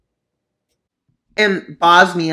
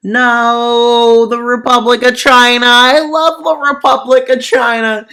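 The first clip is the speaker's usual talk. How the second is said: fake crying; 7 semitones higher, 8 dB louder than usual. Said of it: "I'm bad at fake crying".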